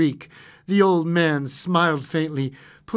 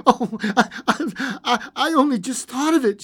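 high, stammering, breathless excitement; hysterical; 10 semitones higher, 4 dB louder than usual. A narrator's reading. Wife laughs and cries at her idea, hysterical. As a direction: stammer it between laughing and crying. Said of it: "she said hysterically".

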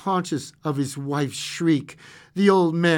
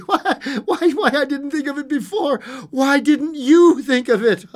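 high, loud, half-laughing cry; hysterical; 12 semitones higher, 7 dB louder than usual. A narrator's reading. Wife demands why nobody thought of it, hysterical. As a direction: tumble it out between laughs and sobs. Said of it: "she said hysterically".

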